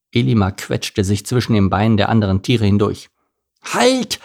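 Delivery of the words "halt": very high, worn-out little donkey. A narrator's reading. Little donkey stops the caravan, high exhausted cry. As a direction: call out in a high, exhausted squeak. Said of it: "krächzte er völlig erschöpft".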